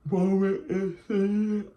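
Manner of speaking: stretched words through a yawn. Real, not acted. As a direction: say it mid-yawn, slowly. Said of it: "excuse me, y'all, for yawning".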